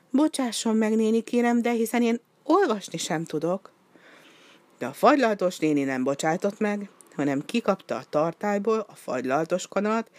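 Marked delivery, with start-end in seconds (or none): 0.1-3.7 higher, small timid penguin voice; pleading little penguin. A narrator's reading. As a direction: beg politely, innocent. Voicing penguin Pikpok.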